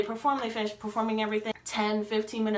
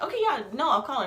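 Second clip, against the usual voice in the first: raised pitch, giving in; acted flustered agreement; 6 semitones higher, 4 dB louder, faster than usual. Insistent friend says ask mom, flustered higher-pitched yes. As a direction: re-enact mildly flustered compliance.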